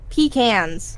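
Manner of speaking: pecans said as PEE-cans; PEE-can pronunciation. Real, not acted. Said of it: "You pronounce it that way".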